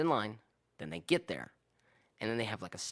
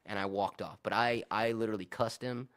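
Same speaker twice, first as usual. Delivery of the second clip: normal talking voice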